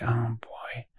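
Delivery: breathy whisper to himself; whispered aside. Retold or imitated. Telling himself under his breath he will go, in a whisper. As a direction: whisper it like a secret thought. Inner thought before a risky act.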